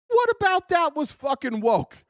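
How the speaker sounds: ordinary talk, nothing marked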